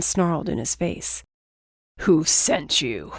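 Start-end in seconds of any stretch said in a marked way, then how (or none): 2.0-3.1 slow, low menacing snap; snapping. Acted. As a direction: demand an answer with menace. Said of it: "I snapped".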